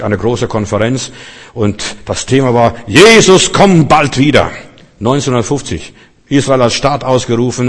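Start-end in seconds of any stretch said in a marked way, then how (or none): 2.9-4.5 calls the motto out, higher and louder; fervent proclamation. Retold or imitated. Preacher proclaiming the conference theme, higher and louder. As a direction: rings out like a rally slogan.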